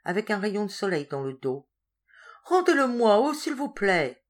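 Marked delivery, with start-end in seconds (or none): 2.4-4.2 high, urgent begging; pleading. A narrator's reading. Madwoman begging the warder to give it back, pleading. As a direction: beg loudly, then draw out the please.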